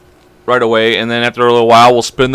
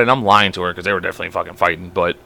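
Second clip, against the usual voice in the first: ordinary talk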